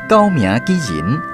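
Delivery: ordinary talk, nothing marked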